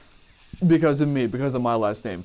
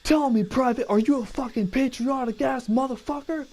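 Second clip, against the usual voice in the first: drill sergeant; 10 semitones higher than usual. Retold or imitated. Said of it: "when he went".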